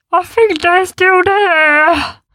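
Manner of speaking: slow, loud, high worried voice; nervous beast. A narrator's reading. The big beast frets to the others, slowly. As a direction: fret from behind the rock. Beast hiding behind rock.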